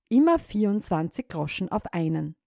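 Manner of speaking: ordinary delivery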